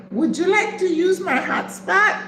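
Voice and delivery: very high-pitched imitation of a young woman; young woman offering help. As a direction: offer it in a high, lilting question. Acts out the helpful young woman.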